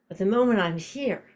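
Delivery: normal talking voice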